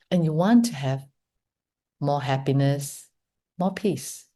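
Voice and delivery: soft, unhurried meditation voice with long pauses; hushed, measured invitation to reflect; guided meditation. Real, not acted. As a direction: guide them gently inward, leaving space between phrases. Leads guided meditation after the talk.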